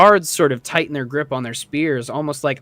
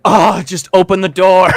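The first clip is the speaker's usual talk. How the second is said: loud, higher exasperated groan of words; 5 semitones higher, 8 dB louder than usual. Acted. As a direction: burst out impatiently. Impatient guards behind a slow opener.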